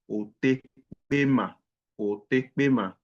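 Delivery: Otekpema drawn out slowly; slow it down for the class; slow demo. Shown as a demonstration. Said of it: "teacher repeats the word".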